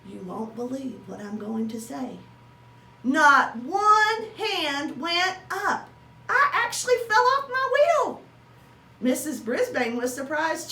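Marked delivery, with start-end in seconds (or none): 3.0-8.2 much higher, louder, slow disbelief; shocked exclaim. Acted. Hamster shocked at no volunteers.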